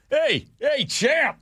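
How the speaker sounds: dad yelling after Frazier. Retold or imitated. Dad calling after the boxer, high eager yelling. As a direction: holler down the sidewalk like a starstruck fan. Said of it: "Throwing every name at him".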